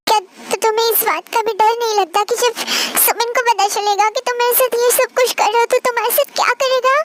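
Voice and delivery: ordinary delivery, nothing marked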